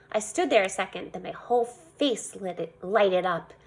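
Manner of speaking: ordinary delivery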